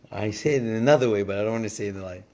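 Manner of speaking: ordinary talk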